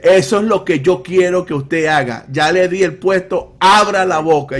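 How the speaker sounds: loud, high-pitched insistent order; commanding. Retold or imitated. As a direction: order the pastor to open up, imitating a forceful woman. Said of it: "ore con autoridad y abra la boca". (imitando una orden enérgica, en voz alta)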